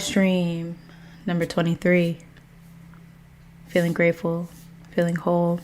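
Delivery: soft, measured meditative delivery; mindful opening. Acted. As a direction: settle listeners gently into the present, phrase by phrase. Opens livestream with slow mindful intentions.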